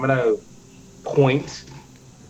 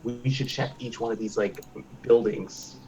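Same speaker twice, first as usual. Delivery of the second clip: hushed; 2 semitones lower, 9 dB quieter than usual. Acted. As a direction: say it softly, keeping the party quiet. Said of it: "I'm gonna tell them to be quiet".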